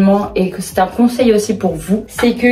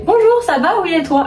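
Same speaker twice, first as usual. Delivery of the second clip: chirpy greeting; 8 semitones higher than usual. Retold or imitated. Imitating polite gym greetings.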